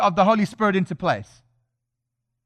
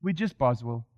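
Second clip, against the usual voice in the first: mock defeatism; 11 semitones lower, 8 dB quieter than usual. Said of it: "you know that I".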